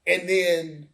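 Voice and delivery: ordinary delivery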